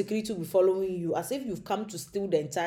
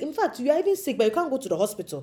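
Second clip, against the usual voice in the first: ordinary talk, nothing marked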